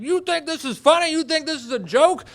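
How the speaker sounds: very high, loud screamed accusation; outraged screaming. Retold or imitated. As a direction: scream it into the night. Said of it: "I'm screaming".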